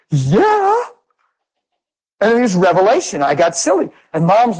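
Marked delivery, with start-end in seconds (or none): 0.1-0.8 squealed high yeah; high delighted squeak; giddy yeah. Retold or imitated. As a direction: let out a silly high yeah. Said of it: "it was like".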